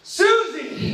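high loud yell. Retold or imitated. Yelling through the door to stop her singing. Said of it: "I've yelled".

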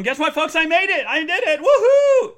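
high, loud victory cry with laughter; triumphant shout. Real, not acted. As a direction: crow with pride. Celebrating finishing.

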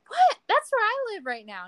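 high, loud burst of retold disbelief; astonished. Passenger finding driver lived in his neighbourhood, astonished. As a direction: exclaim it far up in pitch.

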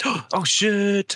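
high, loud startled gasp and curse. Real, not acted. Podcast host surprised by housemate appearing, startled gasp. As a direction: caught off guard mid-sentence.

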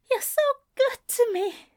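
high-pitched breathless gasp. A narrator's reading. Ezra to lover beneath, overcome, gasping. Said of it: "he heard Ezra gasp".

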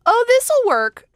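very high, slow delighted mom voice; mom's high find. Retold or imitated. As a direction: squeak like mom found the answer. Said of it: "she's like".

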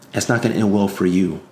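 ordinary talk

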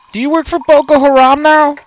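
loud, high disbelieving question; incredulous. Acted. Captive challenges captor on who he works for, incredulous.

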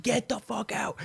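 high-pitched, soft mock yell; imitated exasperated mother. Mother sick of annoying son, imitated high mock yell. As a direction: throw it out high but held back in volume.

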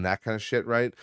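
ordinary delivery

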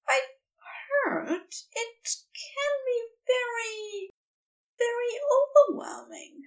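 high, fretful angel voice; high nervous Aziraphale. A narrator's reading. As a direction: let the nerves show in a high voice. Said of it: "Aziraphale sounds nervous".

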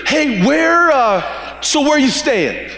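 high, eager call to a teacher walking away; eager calling after Jesus. Acted. Disciples calling to Jesus from behind, eager and high. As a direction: shout after him, hopeful and a bit clumsy. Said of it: "they say".

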